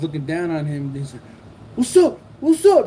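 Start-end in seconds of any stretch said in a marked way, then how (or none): 1.7-2.9 high, loud mock challenge; imitated fight challenge. Rival squaring up at the window, imitated high challenge. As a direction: puff up and taunt, imitating a would-be fighter. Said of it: "like this, watch".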